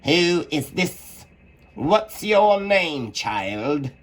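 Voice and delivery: high, slow stern Queen; Queen questioning Alice, high and slow; severe Queen. A narrator's reading. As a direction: demand it slowly as the Queen. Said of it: "the Queen said severely".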